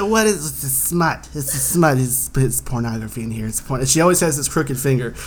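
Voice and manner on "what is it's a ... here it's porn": lightly voiced outraged mom; mock scandalized mom; mom impression. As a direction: do a mother appalled at what's on screen. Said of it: "this is my mom impression".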